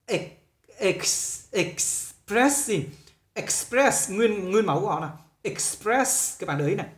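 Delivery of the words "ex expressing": expressing stretched out very slowly from a whispered ex-; slow model word. Shown as a demonstration. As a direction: break off ex- under the breath, then draw out expressing. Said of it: "tương tự từ này".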